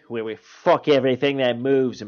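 broad Aussie steakhouse slogan; punchy, crude Australian pitchman. Acted. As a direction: sell it in an Australian accent. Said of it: "the Australian one you can only get here".

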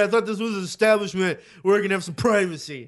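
loud, high, huffy retort; mock indignation. Man retelling his reply when caught, mock indignant. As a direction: act out affronted dignity, loudly.

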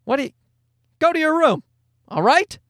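dad ordering his daughter to her room; strict dad. Retold or imitated. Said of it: "Then I'd be like".

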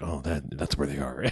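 a low, quiet imitation of the hitman; low mumbled hitman line. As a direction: mumble it low like a tough guy. Statham's character mumbling, voiced.

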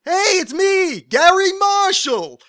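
very high, loud, drawn-out caricature; Gary Marshall. Acted. Mimicking a producer introducing himself, loud high-pitched slow caricature. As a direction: belt it out slowly in a high producer impression. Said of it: "All he says is".

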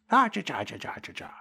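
a sung "cha cha" trailing into a whisper; mock cha-cha flourish. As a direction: hum a little "cha cha" tag, fading to breath. Mock flourish after threat.

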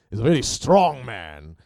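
badly faked Welsh-British accent, loud and low; terrible British accent. Shown as a demonstration. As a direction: have a bad go at a Welsh accent. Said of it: "That was a terrible British accent. ... a Welsh accent".

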